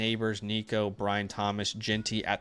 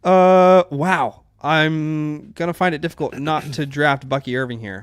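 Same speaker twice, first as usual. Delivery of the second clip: excited; 4 semitones higher, 9 dB louder, slower than usual. Real, not acted. Thrilled by his draft picks.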